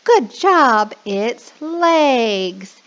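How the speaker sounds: lightly hammed-up good job; praising teacher. Shown as a demonstration. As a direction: cheer them on. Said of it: "And you can say".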